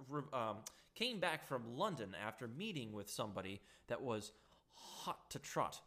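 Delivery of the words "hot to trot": tripping over the words; stuttering. Real, not acted. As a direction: stumble and restart words. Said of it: "I don't know why I'm stuttering today".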